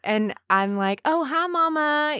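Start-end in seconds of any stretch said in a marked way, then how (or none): 1.0-2.2 put-on high Southern-accented phone greeting; leaned-in Southern accent. Daughter answering mom on the phone, Southern accent. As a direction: slip into a sweet Southern lilt. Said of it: "You lean in a little".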